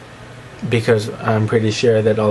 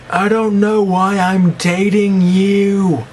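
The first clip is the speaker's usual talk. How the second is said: exaggerated mock voice; 8 semitones higher, 3 dB louder, much slower than usual. Acted quote of third love type.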